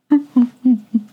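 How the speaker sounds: laughing in disbelief; exasperated laugh. Real, not acted. Host laughing at the story, exasperated. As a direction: burst out laughing. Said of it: "I'm laughing".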